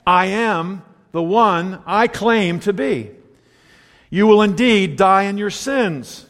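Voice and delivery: slow, weighty declaration; emphatic. Acted. Pastor reading Jesus' warning to his congregation, emphatic. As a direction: spell out each phrase with firm stress. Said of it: "Pretty emphatic".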